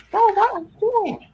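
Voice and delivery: high startled scold at the dog; talking to the dog. Real, not acted.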